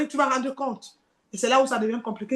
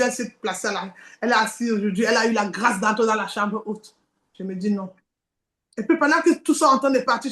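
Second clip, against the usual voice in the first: ordinary talk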